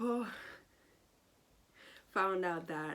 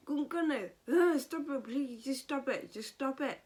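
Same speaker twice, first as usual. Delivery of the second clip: higher, louder, exasperated plea to stop; fed-up pleading; 7 semitones higher, 5 dB louder than usual. Real, not acted. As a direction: beg him to quit it, utterly fed up. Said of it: "I was so fed up with this character".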